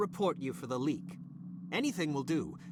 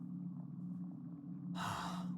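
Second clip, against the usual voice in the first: weary sigh; 10 dB quieter than usual. Acted. Frustrated boss after tirade.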